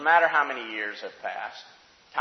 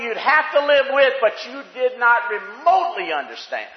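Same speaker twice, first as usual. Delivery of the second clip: loud, high-pitched preacher's cry; full-force preaching; 11 semitones higher, 11 dB louder than usual. Real, not acted. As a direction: preach it with raised, urgent force.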